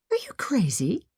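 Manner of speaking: alarmed unvoiced whisper. Maggie to Piper, horrified at the plan, whispering. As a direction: hiss it under your breath, shocked.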